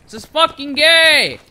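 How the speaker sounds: loud gamer complaint; raging. Real, not acted. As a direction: hammer each repeat in frustration. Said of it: "I was raging a little bit there".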